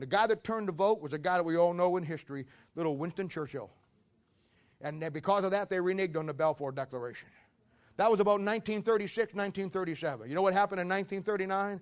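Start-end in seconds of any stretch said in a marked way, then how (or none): none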